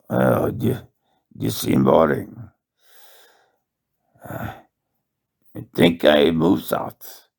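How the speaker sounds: light mock Russian accent, musing aloud; a hint of a Russian accent, half-hearted. Early Canadian wanderer thinking aloud in a faint Russian accent. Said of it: "Sounds more like a Russian accent".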